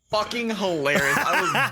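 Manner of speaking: laughing through the words. Real, not acted. Telling friends about the video, laughing. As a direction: giggle it out.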